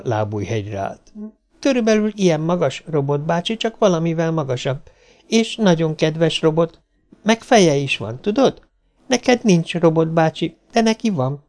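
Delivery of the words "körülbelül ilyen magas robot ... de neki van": put-on high child's voice, chatty and earnest; small girl describing her friend to a robot; pitched-up, innocent child chatter; eager little girl.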